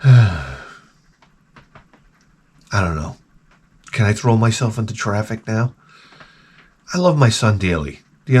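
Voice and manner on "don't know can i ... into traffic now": ordinary talk, nothing marked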